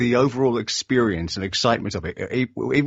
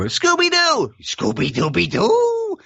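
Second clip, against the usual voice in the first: much higher, slow cartoon dog howl; Scooby-Doo voice; 3 dB louder, slower than usual. Shown as a demonstration. Voice actor showing off his Scooby voice, high cartoon dog. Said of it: "I also am a voice actor".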